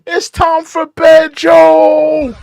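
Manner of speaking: high, loud taunting yell; mock yell. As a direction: holler at him to go home. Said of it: "Somebody should just yell out".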